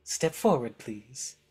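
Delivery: high, swooping voice of a witchy overlady; Baba Yaga, grand hostess. Acted. Baba Yaga greeting her players, high and ceremonious.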